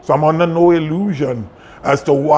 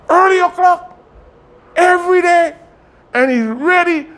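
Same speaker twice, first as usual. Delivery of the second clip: much higher voice, emphatic phrases; high-pitched exclamation; 3 dB louder than usual. Real, not acted. Exclaiming over son's early rising.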